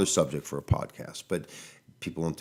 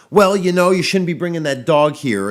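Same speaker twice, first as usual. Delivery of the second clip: mock indignant retort, much higher and louder; defensive owner; 8 semitones higher, 8 dB louder than usual. Mocking the defensive owner who blames him, loud indignant retort. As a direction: snap back like a huffy, defensive owner. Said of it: "It's met with a, like".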